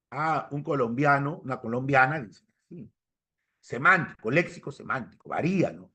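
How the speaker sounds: ordinary delivery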